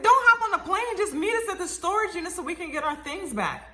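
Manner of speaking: high, urgent begging; retold desperate appeal, starting loud; frantic plea.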